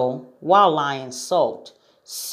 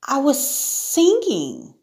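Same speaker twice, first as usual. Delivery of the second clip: put-on high girl's voice, drawn out; small storybook girl explaining; innocent Sarah; 11 semitones higher, slower than usual. A narrator's reading. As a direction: answer slowly and meekly, pitched high. Sarah answers the cross lion.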